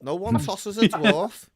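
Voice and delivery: slow, high Scouse dwarf; Gimli. Acted. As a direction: draw it out in indignant Scouse. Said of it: "Gimli in the fucking".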